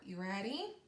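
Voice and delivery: normal talking voice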